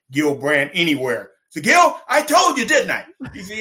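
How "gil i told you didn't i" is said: loud, high mock shout; I-told-you-so. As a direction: re-enact taunting the scout at full voice. Taunting scout who passed, retold.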